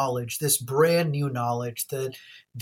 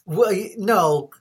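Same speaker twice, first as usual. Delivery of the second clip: ordinary talk, nothing marked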